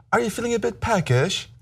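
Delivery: lightly put-on British accent, lilting upward question; imitated British flight attendant. Flight attendant asking a passenger on the London flight, mock British accent. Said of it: "flight attendant comes and she asks me".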